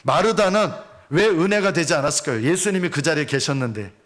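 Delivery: ordinary talk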